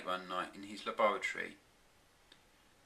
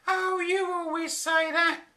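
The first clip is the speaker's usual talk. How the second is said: high, louder exasperated scolding; exasperated wife; 6 dB louder than usual. A narrator's reading. Flora scolding her husband about time, high exasperated voice. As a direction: needle him, high and fed up. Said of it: "she said".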